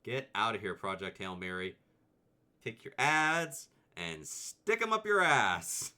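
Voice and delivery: playful scolding of an ad; mock outrage. Real, not acted. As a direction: tell off the ad. Skipping an ad.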